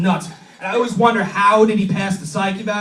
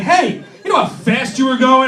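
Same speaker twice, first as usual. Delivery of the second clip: raised, higher mock cop voice; police officer; 5 semitones higher, 5 dB louder than usual. Officer to pulled-over driver, mock stern challenge. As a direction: call out like a traffic cop. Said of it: "officer will be like".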